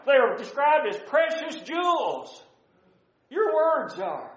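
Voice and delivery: high-pitched, measured preaching cadence; impassioned preaching. Real, not acted. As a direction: preach each phrase as a lifted declaration. Preacher builds up scripture list.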